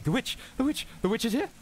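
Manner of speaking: high, hurried panicky murmur; panicked whispering. Acted. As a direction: pass it along in a frightened hush. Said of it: "panicked and hurried, like, whispering".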